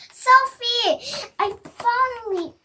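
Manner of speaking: high, loud relief; relieved cry. Acted. Boy finding his lost sister, crying out in relief. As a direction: blurt it out in joy. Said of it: "Sam cried".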